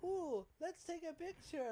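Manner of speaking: high, sugary fake-friendly voice; sweet scammer voice. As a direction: coax like a street scammer.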